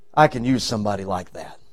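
very low, soft, almost private; hushed. Acted. God deciding on young David, hushed. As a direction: drop the voice right down after a pause. God's quiet verdict after a pause.

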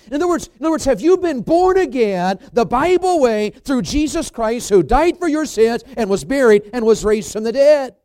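loud, high-pitched bursts on key words; full-force preaching. Real, not acted. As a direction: preach it with driving emphasis.

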